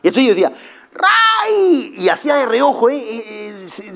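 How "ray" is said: calling the dog. Retold or imitated. Owner calling his dog Ray, high ringing shout. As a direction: shout his name so he hears.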